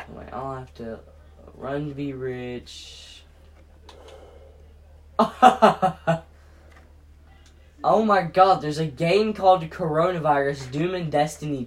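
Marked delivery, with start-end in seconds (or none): 5.2-6.2 loud sudden laugh; burst of laughter. Real, not acted. Laughing at game name.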